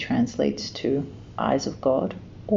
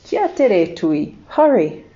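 urgent call; 2 semitones higher, 6 dB louder, slower than usual. A narrator's reading. Kiwi urging friend to hurry.

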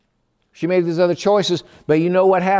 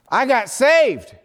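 joyful news; 8 semitones higher, slower than usual. Retold or imitated. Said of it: "She says".

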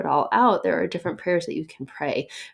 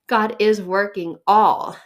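"God is working all," slow, louder, weight on "all"; stressed, slow; 2 semitones higher, 6 dB louder, slower than usual. Shown as a demonstration. Preacher driving home the verse, slow and louder. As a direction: drag it out and press "all". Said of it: "the emphasis on the key words".

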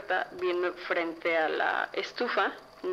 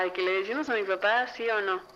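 ordinary talk, nothing marked

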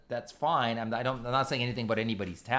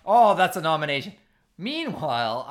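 ordinary talk

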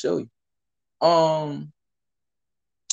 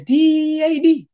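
mocking spell-out; 12 semitones higher, 7 dB louder, much slower than usual. Spelling out in raised voice.

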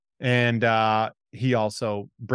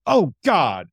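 loud, raised mock panic; alarmed cry; 4 semitones higher, 5 dB louder than usual. Retelling Daryl hearing of the gun, loud mock panic. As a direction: cry out in playful horror.

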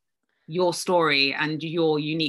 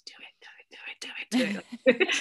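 whispered, insistent egging-on; urgent whisper; 7 semitones higher, much faster than usual. Acted. Soul nudging her to take action, whispering. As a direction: whisper it like a nudge in the ear. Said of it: "we know it's whispering in our ear".

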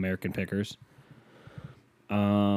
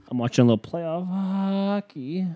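higher, slow announcer read, ending on a rise; sportscaster voice; 9 semitones higher, slower than usual. Acted. Host introducing the game to listeners, broadcaster voice. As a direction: play it like a TV hockey broadcaster.

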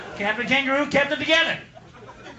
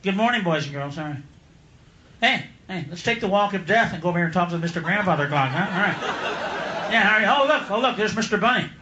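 put-on discouraged kids' host; 6 semitones lower than usual. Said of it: "He was like".

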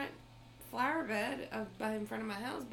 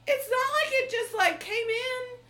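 exasperated disbelief; 11 semitones higher, 6 dB louder than usual. Retold or imitated. Imagined thought, shouting confusion.

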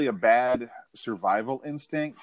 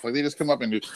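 normal talking voice

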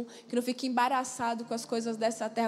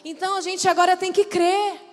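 loud, driving preacher's appeal; rising revival-style exhortation; fervent preaching; 9 dB louder than usual. Acted. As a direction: preach it out with full force. Preaching climax to congregation.

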